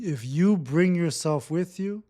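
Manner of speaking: ordinary delivery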